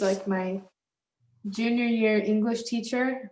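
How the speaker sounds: ordinary delivery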